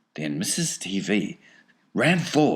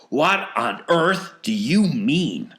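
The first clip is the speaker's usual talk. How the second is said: slow, higher, indignant question; sharp demand; 9 semitones higher, 6 dB louder, slower than usual. A narrator's reading. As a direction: demand an explanation, weighing each word. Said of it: "asked Mister T V sharply".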